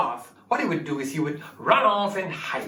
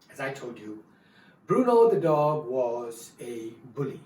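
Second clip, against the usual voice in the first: plain reading voice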